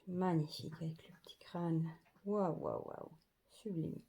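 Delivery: soft unvoiced whisper over the card. Real, not acted. Showing tarot cards to viewers, a hushed whisper. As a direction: breathe the words, almost no voice.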